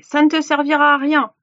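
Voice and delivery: scolding. A narrator's reading. Old witch to weeping Gretel, scolding.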